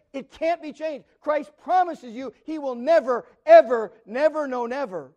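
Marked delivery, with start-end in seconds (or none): 1.2-5.1 "never, ever, never" punched, peaking on "ever"; piled-up nevers. Real, not acted. Preacher hammering home Christ's promise, emphatic. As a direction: stack the nevers, each one harder.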